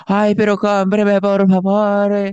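high, slow pleading street vendor; imitating a street vendor begging on a first date; whiny vendor plea. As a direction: beg like a pitiful street vendor.

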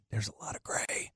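hushed whisper, barely voiced; whispered discovery. Host telling of gray in his beard, whispered. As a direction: whisper it like a grim secret.

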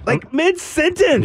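high-pitched, drawn-out disbelief; incredulous. Real, not acted. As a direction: squeak it out slowly in amazement. Amazed at the changing voice.

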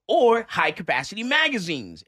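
exaggerated loud emphasis; all-caps reading. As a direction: declaim it as if in capital letters. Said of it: "in all caps".

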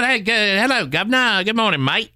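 high hammed-up English accent; mock English accent. Mocking immigrants who keep their English accent, high and hammed-up. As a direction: chirp a cartoon English accent. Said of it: "But they still".

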